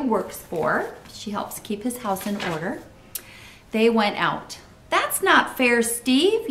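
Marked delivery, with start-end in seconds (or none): 4.9-6.5 high-pitched, protesting call; mild yell of complaint at a playmate; child's yell. A narrator's reading. Janet accusing Steve over apples, high protesting yell. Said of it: "yelled Janet".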